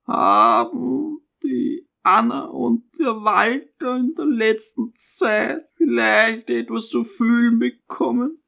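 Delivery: high, halting, anxious mother; hesitant and high, as if regretting each syllable; fearful and cautious. A narrator's reading. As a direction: let the question creep out fearfully. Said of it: "Die Frage stahl sich unendlich angstvoll und behutsam".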